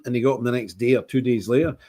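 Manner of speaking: ordinary talk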